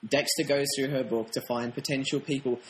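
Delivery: usual speaking voice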